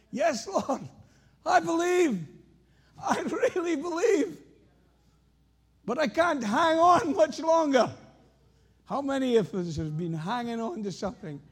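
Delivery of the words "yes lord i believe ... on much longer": high, loud, strained pleading; frantic pleading. Pretend. Man hanging from the branch, high frantic pleading. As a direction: beg in panic, voice up high. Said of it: "following conversation ensued".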